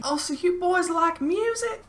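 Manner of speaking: put-on very high falsetto old-lady voice; older woman. Older woman joining the music talk, high mock old-lady voice. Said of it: "this woman decides to stand up and join in".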